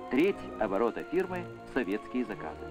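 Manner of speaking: ordinary talk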